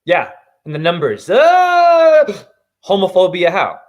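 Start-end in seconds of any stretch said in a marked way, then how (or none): 1.3-2.2 high, loud yelp; sudden shout. Real, not acted. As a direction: let out a sharp cry. Outburst mid rant.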